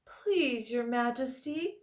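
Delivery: a hint of the high bear voice, pleading; lightly voiced bear. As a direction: gently beg like a sleepy bear. Bear character voice.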